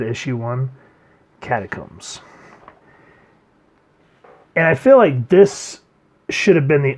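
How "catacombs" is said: low, hushed, ominous title drop; dramatic title read. Shown as a demonstration. Reviewer repeats the issue title, low and ominous. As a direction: drop your voice and make the title loom. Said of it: "And as I just said".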